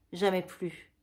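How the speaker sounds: plain reading voice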